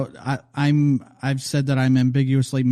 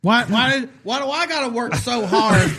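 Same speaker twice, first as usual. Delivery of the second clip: high-pitched mock indignant rant; truck ranter; 9 semitones higher than usual. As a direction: imitate an aggrieved truck guy, pitch way up. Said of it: "get to my truck and rant".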